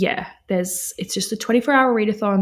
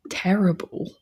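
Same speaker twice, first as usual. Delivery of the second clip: normal talking voice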